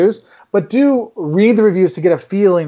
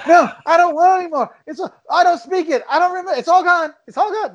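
high-pitched mock pleading; exaggerated, high protest; retold protest; 12 semitones higher than usual. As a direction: beg off in a comically high voice. Retells drunk protest at bar.

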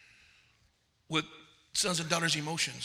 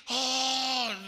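mock-pious drawn-out "oh", pitched up; overly spiritual person; 6 semitones higher than usual. Preacher mocking people who get too spiritual, mock rapture. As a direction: hold one high note, hammed-up rapture. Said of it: "Some people get so spiritually".

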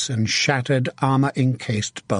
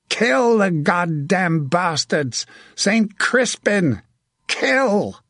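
high, slow, drawn-out war cry; rally the men with a roared shout; battle shout; 7 semitones higher, slower than usual. A narrator's reading. Archer releasing his men to attack, shouting. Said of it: "The shout released the archers".

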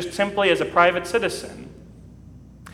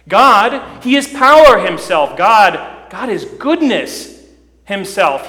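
louder, slow, rising proclamation of God's power; full-force preaching; 3 semitones higher, 5 dB louder, slower than usual. Acted. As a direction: build to a soaring pulpit climax. Preaching builds on God's glory.

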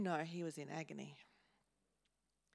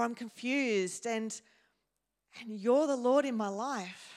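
confused cry to God; 3 semitones higher, 8 dB louder than usual. Acted. Prayer voiced as confused cry.

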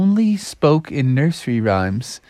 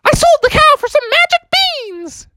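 very high, loud squeaky mouse; little mouse; 3 dB louder than usual. Acted. Mouse pupil volunteers from the back, high squeaky voice. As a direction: pipe up in a tiny falsetto. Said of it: "a little mouse in the back".

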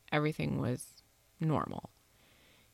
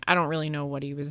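usual speaking voice

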